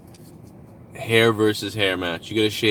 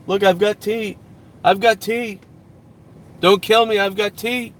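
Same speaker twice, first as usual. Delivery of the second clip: high, pleading voice offering tea; pleading peace offering; 11 semitones higher, 4 dB louder than usual. Pretend. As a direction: beg the neighbor not to hurt you, high-pitched. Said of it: "It's like a fucking peace offering".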